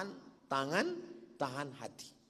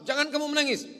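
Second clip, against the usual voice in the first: louder, higher, scolding the women to stop crying; stern rebuke; 11 dB louder, faster than usual. Acted. Umar rebukes a weeping woman, loud and stern. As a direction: snap "Jangan kamu menangis" as a strict order.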